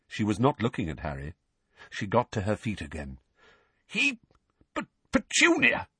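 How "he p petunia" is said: very high, stammering disbelief; spluttering protest. Acted. Uncle objecting to his wife's decision, spluttering. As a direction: splutter and break off, aghast.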